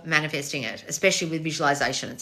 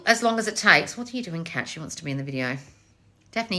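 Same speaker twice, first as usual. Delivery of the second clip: ordinary talk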